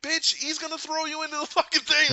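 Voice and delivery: high, loud comic outburst; exasperated yell. Retold or imitated. Viewer thinking at Gamora as she laughs, exasperated shouting. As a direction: yell it in disbelief at a clueless character.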